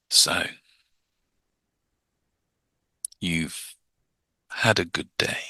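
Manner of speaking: soft, long pauses between words; mismatched slow hypnotist pace; slow and drawn out. Shown as a demonstration. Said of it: "they're gonna get annoyed with you".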